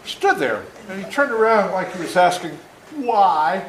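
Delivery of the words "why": plain reading voice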